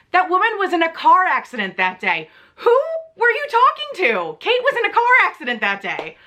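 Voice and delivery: high-pitched indignant rant; exasperated disbelief. Retold or imitated. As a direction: demand answers, appalled. Ranting at the absent agent.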